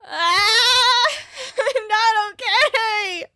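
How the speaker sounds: high, slow anguished cry; distressed yell. Real, not acted. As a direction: wail it out. Distressed reaction.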